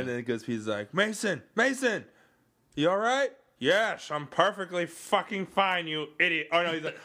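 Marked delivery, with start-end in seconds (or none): 0.9-3.3 high, loud mock-worried shout; calling out. Acting Goodspeed calling out. 3.6-6.5 loud, raised-pitch exasperated retort; mock irritation. Acting Mason's irritated reply.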